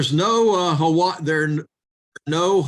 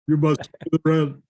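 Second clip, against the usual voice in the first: fast, sneering stage villain; lightly hammed-up villain; melodrama villain; faster than usual.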